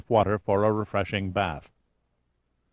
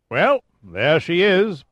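slow, higher and louder officer voice; British major voice; 7 semitones higher, 5 dB louder, slower than usual. A narrator's reading. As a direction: safari leader calling the group's attention. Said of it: "pointed Major Ian Fairweather".

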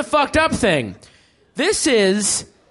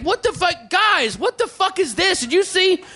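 high-pitched exasperated boss tirade; raised-pitch mock fury at the office; angry editor rant; 7 semitones higher than usual. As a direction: chew out the staff like a furious editor. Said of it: "He's like".

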